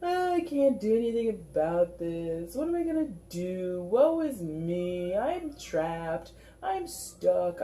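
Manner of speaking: mock the self-pitying client, over the top; hugely sarcastic voice. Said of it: "that's a hugely sarcastic voice".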